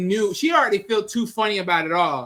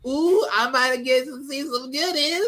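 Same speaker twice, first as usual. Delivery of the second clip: higher, slow, put-on eager creep voice; leering goof; 6 semitones higher than usual. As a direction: savour it like a nosy creep. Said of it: "wanna think".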